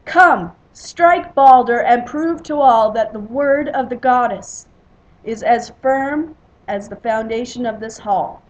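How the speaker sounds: loud bellow easing slightly into firm proclamation; Thor. A narrator's reading. Thor orders the strike to prove the oath, bellowing. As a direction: boom the command out to everyone. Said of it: "Thor rose from across the hall and bellowed".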